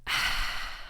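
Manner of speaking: breathy ahh after chugging water; a soft thirst-quenched exhale from the throat; relieved ah after drinking. Shown as a demonstration. After chugging water on a hot day, a relieved breathy sigh. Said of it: "and you go".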